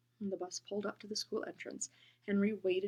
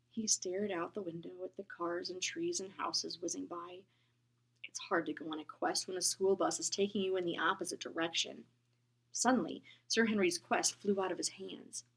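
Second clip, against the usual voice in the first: usual speaking voice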